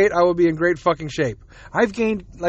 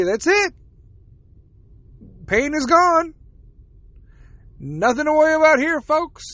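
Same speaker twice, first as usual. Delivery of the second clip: much higher, put-on reassuring announcer; cheery mock promise; 8 semitones higher than usual. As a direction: sell it as a breezy all-clear to the folks. Voices the doctors' cheery promise.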